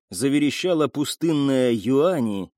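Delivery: ordinary delivery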